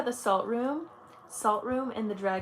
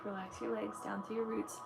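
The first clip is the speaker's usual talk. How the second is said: soft, quiet meditation voice guiding through each chakra; guided meditation; 12 dB quieter than usual. Acted. Leads a chakra grounding meditation.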